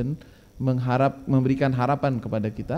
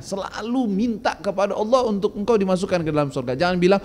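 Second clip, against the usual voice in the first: ordinary delivery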